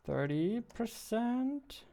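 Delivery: high, slow disbelieving question; can't quite believe the number; surprised. Real, not acted. Dev sees rising death rate.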